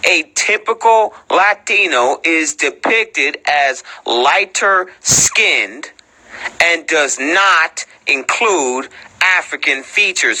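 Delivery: slow, deliberate word-by-word reading; drawn-out delivery to drive the point home; slow emphatic reread. Shown as a demonstration. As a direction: spell it out slowly, pausing between phrases. Rereads sentence slowly after "What?.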